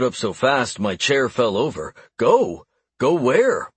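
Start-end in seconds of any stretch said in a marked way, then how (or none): 2.2-3.7 higher, louder startled questions; shocked. A narrator's reading. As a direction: blurt it out in shock. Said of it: "Those simple words shocked me".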